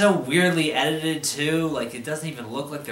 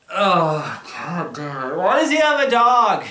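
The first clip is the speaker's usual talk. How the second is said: exasperated outburst; 3 semitones higher, 5 dB louder, slower than usual. Real, not acted.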